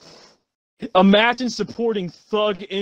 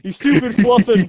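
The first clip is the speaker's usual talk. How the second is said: loud country yell; 5 semitones higher, 7 dB louder than usual. Pretend. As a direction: yell it like a furious country boy. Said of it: "Say, say, say the".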